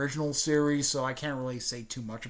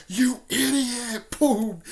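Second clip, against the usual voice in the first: loud, high, drawn-out scolding; hammed-up sensei outburst; mock angry yell; 10 semitones higher, 6 dB louder, slower than usual. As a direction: yell it slowly, then punch out the impact. Sensei scolds and punches Lee.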